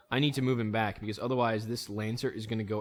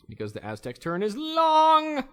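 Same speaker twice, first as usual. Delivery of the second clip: exasperated whine; 7 dB louder, slower than usual. Real, not acted. Exasperated complaint about game.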